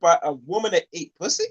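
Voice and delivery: ordinary delivery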